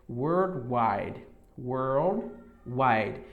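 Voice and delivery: Worldwide with a curled R and dark L; careful English Worldwide; English worldwide demo. Shown as a demonstration. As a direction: drill Worldwide slowly, twice. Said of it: "Fica desse jeito".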